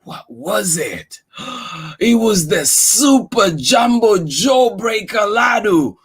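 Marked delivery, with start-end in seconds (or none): 2.0-6.0 excited announcement. Acted. Dramatic reveal to child.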